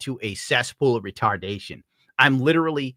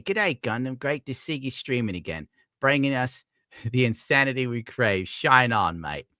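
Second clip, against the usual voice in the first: put-on Australian accent; Australian accent for a viewer's message. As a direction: read it like a friendly Aussie mate. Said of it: "how to do an Australian accent".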